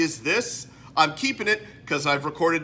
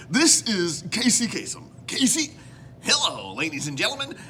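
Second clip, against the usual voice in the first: swooping, high radio-host cadence; put-on presenter lilt, pitched up; Casey Kasem; 4 semitones higher than usual. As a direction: work up a countdown host impression. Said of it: "my Casey Kasem impression".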